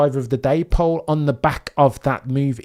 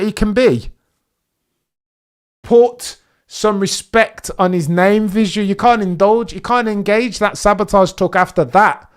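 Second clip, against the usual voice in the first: loud, higher, fast told-you-so to chat; excited gloating; 7 semitones higher, 7 dB louder than usual. Real, not acted. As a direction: rub it in, buzzing. Gloating to chat after overtake.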